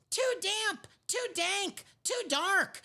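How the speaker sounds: high, tiny squeaking mouse voice; small mouse complaining about the damp cave; squeaky mouse. Acted. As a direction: squeak it out like a fussy little mouse. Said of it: "Mouse squeaks".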